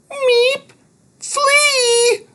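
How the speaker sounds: very high squeaky meep; tiny creature voice. Acted. Thud warns the kids in a high squeak. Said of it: "Thud said".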